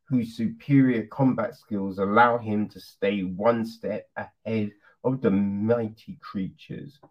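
low, dramatic trailer-style read; film blurb read. Acted. Reviewer reading the film's synopsis to viewers, in a low trailer-style voice.

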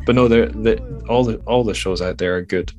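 ordinary delivery

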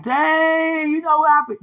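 high, loud mock heckle; instigating bystander. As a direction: egg them on like a rowdy onlooker.

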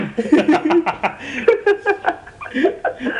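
real helpless high laugh; high-pitched laughter. Real, not acted. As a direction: burst of high, long laughing. Teasing about tonight's work.

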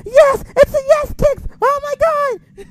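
excited shout. Retold or imitated. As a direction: scream it with pure joy. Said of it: "I'm like".

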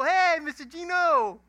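high, slow, booming holler; shouted greeting. Retold or imitated. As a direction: holler it back to an old friend. Calling back to Gino.

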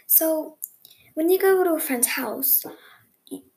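ordinary talk

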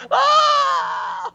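long, very high loud scream; shrieked "aah", held out at full pitch; retold scream. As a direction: imitate screaming in your sleep. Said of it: "I was screaming".

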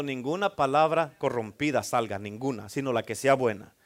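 low and soft, each word weighed; hushed solemn reading. Shown as a demonstration. Pastor rereads the verse to the church, hushed and low. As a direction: drop the voice and let the verse sink in.

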